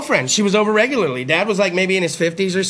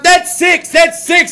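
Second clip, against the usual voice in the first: put-on excited kid; 8 semitones higher, 4 dB louder, much slower than usual. Boy counting horn blasts.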